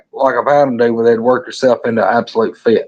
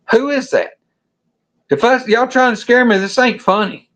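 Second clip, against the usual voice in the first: much higher-pitched, uneasy protest; retold as a spooked buddy, voice pitched up; rattled buddy; 11 semitones higher than usual. Spooked buddy accusing friends of a prank, rattled.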